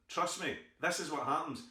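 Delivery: usual speaking voice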